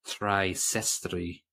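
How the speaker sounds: "Sestry" said the Russian way, slow Russian accent; slowly in a Russian accent, "Three Sestry". Shown as a demonstration. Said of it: "which is my Russian accent".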